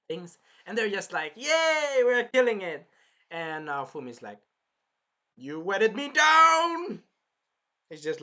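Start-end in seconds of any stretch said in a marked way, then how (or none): 1.4-2.9 high, loud celebratory yell; whooping imitation, drawn-out yay; mock cheer. Acting out party cheering in battle. 5.4-7.0 high, loud, drawn-out yell; slow-building outraged holler; outraged mock shout. Acting out drenched character's outrage.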